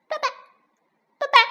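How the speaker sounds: tiny, high, chirping voice; duckling calling daddy. Acted. Newborn duckling calls to the fox, high eager chirping. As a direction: call out like an eager baby duck.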